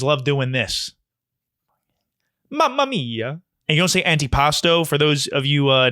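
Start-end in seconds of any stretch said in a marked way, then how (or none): 2.5-3.4 high, sung-out Italian "Mamma mia"; hammed-up Italian exclamation with a high lilt. Shown as a demonstration. Showing non-Italians the Italian "Mamma mia". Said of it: "and do this".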